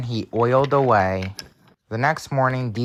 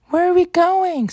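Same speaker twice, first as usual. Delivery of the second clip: very high, louder startled coach; Clarabelle; 5 dB louder than usual. Acted. Coach woken up with a shock, high startled voice. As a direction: squeak it high in confusion. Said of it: "said Clarabelle".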